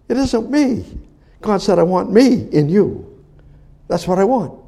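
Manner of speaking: high, loud pulpit preaching; high-pitched impassioned sermon voice; fervent preaching. Acted. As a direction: preach it hard to the congregation. Preaching with raised voice.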